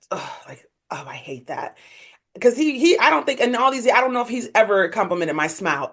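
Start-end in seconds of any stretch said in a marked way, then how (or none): 0.1-1.8 low, very soft disgusted grumble; imitated cringe. Ex hearing her get a compliment, imitated cringe. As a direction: imitate him recoiling under his breath. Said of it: "see him damn near cringe".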